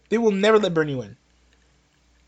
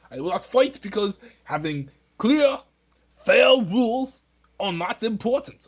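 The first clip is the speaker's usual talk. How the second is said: put-on a light, half-hearted Bernie Sanders accent, giving in; lightly mocking Bernie Sanders accent, resigned; a faint Bernie Sanders accent, meekly yielding to the party; bad Bernie Sanders accent. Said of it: "This is a bad accent".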